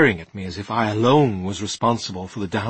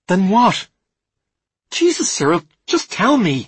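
high, loud, impatient outburst; exasperated demand; 11 semitones higher, 10 dB louder than usual. A narrator's reading. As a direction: demand an answer at the end of your patience. Alice loses patience with Cyril's evasions.

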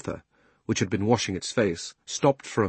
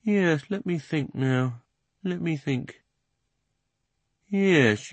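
horse voice; 5 semitones higher than usual. Acted. Horse remembering.

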